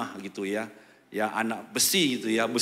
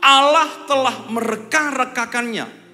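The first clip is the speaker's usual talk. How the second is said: Joseph's proclamation; 9 semitones higher, 9 dB louder than usual. Acted. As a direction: proclaim it like a forgiving ruler. Quoting Joseph's words to brothers.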